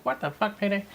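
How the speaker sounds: high mimicked echo of swearing; Cordelia. Retelling how she echoed the swearing, high mimicry. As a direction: copy it back in a high, soft voice. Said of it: "And she'd be like".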